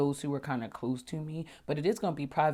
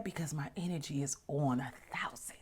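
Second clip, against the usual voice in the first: whispered aside; 8 dB quieter than usual. Real, not acted. Aside on her energy.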